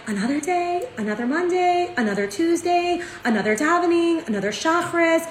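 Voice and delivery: sing-song of someone tired of the sameness; mock weary sigh. Waking up to another routine day, mock weary. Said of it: "we wake up in the morning and we're like".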